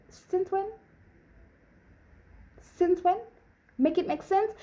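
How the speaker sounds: high, incredulous questions; indignant disbelief. Retold or imitated. Accused of cheating by her crush, high incredulous protest. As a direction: throw it back at him, baffled.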